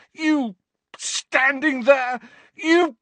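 fury that runs out of words; choking rage. A narrator's reading. Harry roaring, words fail.

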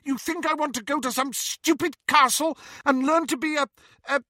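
high-pitched indignant sputter; scornful outburst. A narrator's reading. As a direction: scoff angrily, faltering for the insult. Petunia scorns sister's school, lost for words.

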